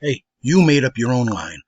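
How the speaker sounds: emphatic repeat of the accusation. Retold or imitated. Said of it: "I just started".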